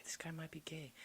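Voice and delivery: hushed aside to himself; whispered thought. Retold or imitated. Thinking to himself about the coffee guy, whispering.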